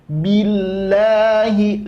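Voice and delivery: billahi, the lam doubled and pressed, slow; stressed lam in billahi. Shown as a demonstration. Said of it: "lam di lafaz Allah tasydid". (lam billahi ditekan, pelan)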